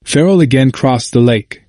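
plain reading voice

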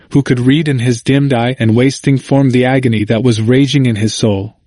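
plain reading voice

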